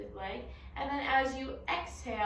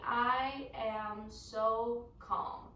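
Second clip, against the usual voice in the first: each word stretched out slowly; slow calm phrase; much slower than usual. Shown as a demonstration. Teacher introducing the calm phrase, said slowly. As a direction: say it slowly and calmly. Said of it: "our phrase this week is going to be".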